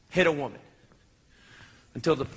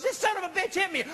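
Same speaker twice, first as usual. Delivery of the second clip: wife accusing; 11 semitones higher than usual. Retold or imitated. Wife accusing him to cops.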